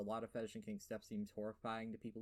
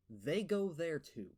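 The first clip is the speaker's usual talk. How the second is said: emphatic; 4 semitones higher, 6 dB louder, slower than usual. Real, not acted. Damning the level designer.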